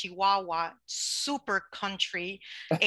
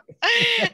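high burst of laughter; 9 dB louder than usual. Real, not acted. Laughs at Chihuahua joke.

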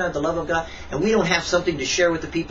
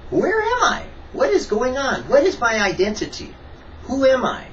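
bewildered questions; 8 semitones higher than usual. Retold or imitated. Retelling his own confused questions to God.

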